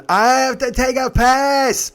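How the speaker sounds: loud, high, drawn-out passenger yell; imitated yell. Drunk passenger announcing to the car, yelling. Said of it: "He yells it".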